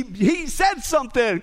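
ordinary delivery

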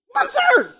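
very high, incredulous "one third"; incredulous repeat. Real, not acted. Preacher on churches leaving out prophecy, high-pitched disbelief. Appalled repeat of what is left out.

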